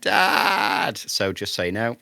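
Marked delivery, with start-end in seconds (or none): 0.0-1.0 high, loud call imitating a young boy; mock child's shout. Host imitating his boys interrupting the podcast, mock shouting. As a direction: shout like a kid calling for a parent. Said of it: "young boys shouting and screaming in background".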